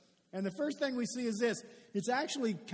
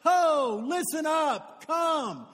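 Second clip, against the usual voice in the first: loud, higher barker's holler; carnival barker yell; 4 semitones higher, 5 dB louder, slower than usual. Acted. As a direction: call the crowd to listen up. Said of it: "Somebody yelling".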